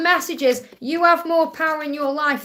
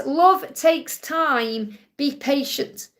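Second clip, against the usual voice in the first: slow reading; much slower than usual. Shown as a demonstration. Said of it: "It says".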